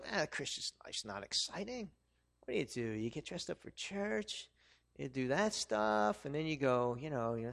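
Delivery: a hint of bored sneer; mocking the world. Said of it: "the world would try to tell us".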